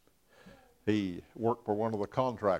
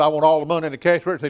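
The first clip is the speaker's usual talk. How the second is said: mock robber demand; 4 semitones higher, 8 dB louder, much faster than usual. Robber's demand in retold story.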